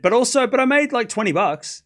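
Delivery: normal talking voice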